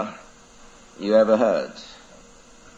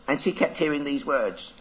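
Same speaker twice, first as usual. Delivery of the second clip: plain reading voice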